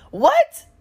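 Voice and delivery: very high, loud incredulous yelp; mock shocked. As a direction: imitate her disbelief way up high.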